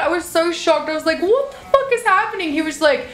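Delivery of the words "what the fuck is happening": high, incredulous squeal; stunned disbelief. Retold or imitated. Surprised by her boyfriend's party, high stunned squeal.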